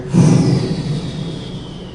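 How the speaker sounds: long, drawn-out breathy exhale; acted weary sigh. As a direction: let out a slow wordless sigh. Acted answer to basketball question.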